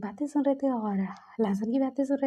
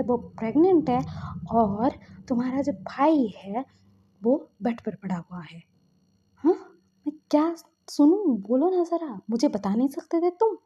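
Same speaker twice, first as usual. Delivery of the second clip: angry; 4 dB louder than usual. Acted. Elder brother scolding friend for hiding the marriage, angry shout. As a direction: tear into him for hiding it. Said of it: "season बहुत गुस्से में थे".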